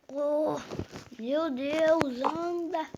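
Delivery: ordinary talk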